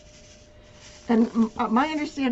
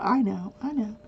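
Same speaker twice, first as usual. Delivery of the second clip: normal talking voice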